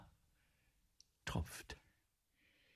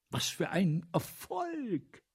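delighted; 7 semitones higher, slower than usual. Acted. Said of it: "Der Kassierer".

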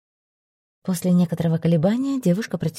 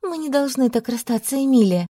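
exclaiming; 8 semitones higher than usual. A narrator's reading. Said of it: "воскликнула она".

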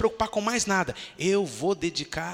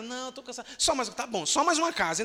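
higher, pleading push for one more house; mock pastor coaxing, higher pitch; insisting; 7 semitones higher, faster than usual. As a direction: coax the tired member on.

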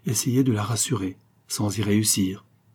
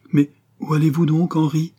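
high, louder anxious question; heartfelt, piercing plea; 7 semitones higher, 5 dB louder than usual. A narrator's reading. Young woman asking where her beloved is going, heartfelt alarm. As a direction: ask urgently, pitch well raised. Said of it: "un accent qui pénétra l'âme".

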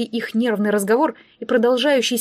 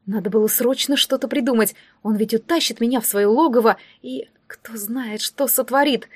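ordinary delivery

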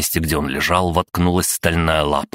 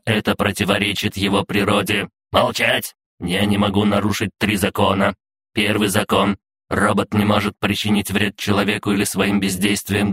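steady, higher monotone robot voice; officious robot guard; 4 semitones higher than usual. Acted. Robot guard reciting its laws to intruders, in an even raised pitch. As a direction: recite it evenly like a machine.

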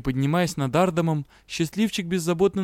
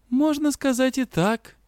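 melancholic; 10 semitones higher, slower than usual. Acted. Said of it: "меланхолично отозвалась".

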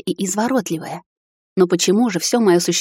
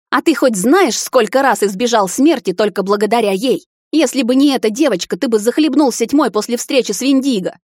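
high, loud reproach; shouting; 5 semitones higher, 4 dB louder than usual. A narrator's reading. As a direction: hurl it at him. Mother berates son. (мать кричит на сына, выше и громче)

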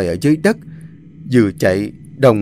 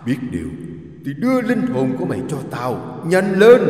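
eerie demon voice, softer, rising at the end; demonic double voice; 3 semitones higher, 4 dB quieter than usual. Acted. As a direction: taunt like a demon hunting prey. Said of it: "tiếng nói kinh dị của con quỷ".

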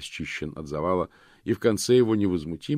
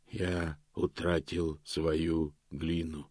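slow, soft stone monotone; a lost golem speaking slowly; 3 semitones lower, 6 dB quieter, much slower than usual. A narrator's reading. Сказал голем. (медленный каменный монотон)

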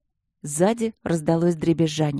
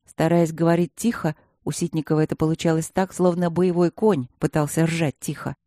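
ordinary delivery, nothing marked

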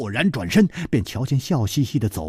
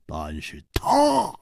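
low, drawn-out hesitation; slow down and lower the voice; slowly; 8 semitones lower, 3 dB quieter, slower than usual. Acted. Killer making an exception for Zhang Xiaolin, slowly. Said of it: "缓缓地说".

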